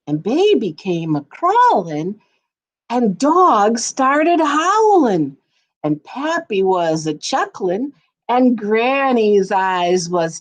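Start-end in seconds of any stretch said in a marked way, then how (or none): 2.9-5.4 higher, slow, drawn-out howl; howling dog line. A narrator's reading. Reading to children about the howling dog, higher and slow. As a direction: stretch it up like a howl.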